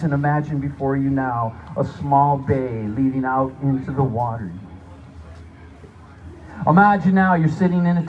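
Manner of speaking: energetic showman narration; guided hallucination. Acted. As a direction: paint the scene for them.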